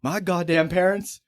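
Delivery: put-on high, whiny kid griping about walking home; whiny kid rant. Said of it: "I'm like".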